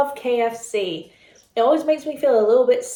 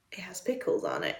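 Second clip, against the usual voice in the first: whisper; 3 semitones lower, 12 dB quieter than usual. Real, not acted. Aside about the burger.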